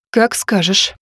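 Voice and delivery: ordinary delivery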